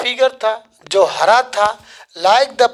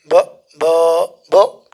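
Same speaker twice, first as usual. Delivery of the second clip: demonstrated stutter, broken-off syllables; acted halting stammer; 6 semitones lower, much slower than usual. Shown as a demonstration. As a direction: get stuck repeating the opening sound. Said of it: "इस तरह से क्या करके वो हकलाने लगी".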